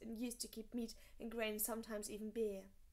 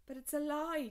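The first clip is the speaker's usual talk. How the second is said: higher, louder protest; insisting; 5 semitones higher, 7 dB louder than usual. A narrator's reading. John protesting his father's innocence to Mormont. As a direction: push back hard, he won't accept it. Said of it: "John insisted".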